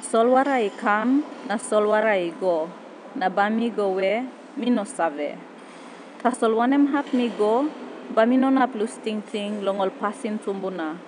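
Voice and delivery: spoken in Tok Pisin; papua new guinea pidgin. Acted. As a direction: recite in PNG pidgin. Tok Pisin poem verse.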